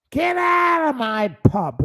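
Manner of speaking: high-pitched landlady shout; Peggy Mitchell. Acted. Host quotes the pub landlady's catchphrase, shouted high. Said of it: "that's what she said".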